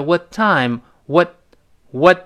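"what" with its final t held, not released; unreleased t. Shown as a demonstration. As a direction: stop the t in "what" on the tongue before "time". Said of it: "中间那个t失去爆破了". (失去爆破，what的t顶住不送气)

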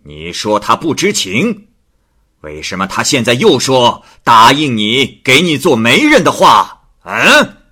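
loud, slow, enraged magistrate; furious. Acted. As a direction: roar at her for lying in court. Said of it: "大怒道". (太守大怒，厉声质问胭脂)

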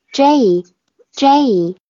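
slow emphatic J; correct J. Shown as a demonstration. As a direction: correct a student by stretching the A of J. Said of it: "读那个合口双元音A的元音".

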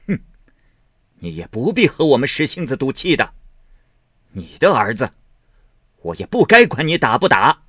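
loud, high sarcastic tirade; cold sneering anger. A narrator's reading. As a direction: sneer and lash out. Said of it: "贾母便冷笑道".

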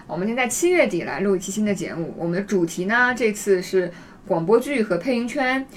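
ordinary talk, nothing marked

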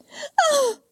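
very high anguished scream; piercing scream. Acted. Mother seeing her children's bodies, piercing scream. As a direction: scream at the sight of the dead children. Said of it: "阮云欢尖叫".

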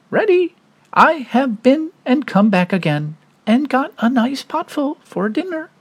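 high, cheeky pig voice; little pig voice. Acted. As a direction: gloat in a high voice.

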